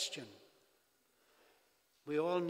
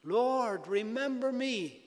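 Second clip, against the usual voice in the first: Lord, remember me cried out higher; preacher voicing the thief's raised cry; dying thief's cry; 6 semitones higher, 3 dB louder than usual. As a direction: plead like a dying man on a cross. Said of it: "he cried out to Jesus".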